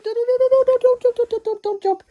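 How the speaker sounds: high, loud panicked don'ts; panicked squeal. Real, not acted. Speaker squealing as the kitten jumps off her shoulder, panicked. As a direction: squeal in alarm as the cat leaps.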